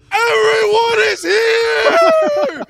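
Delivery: very high, drawn-out full-force shriek; re-enacted fan scream. Host reliving the reveal to his wife, ecstatic scream. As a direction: scream it at the top of your lungs. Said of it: "screaming at the top of my lungs".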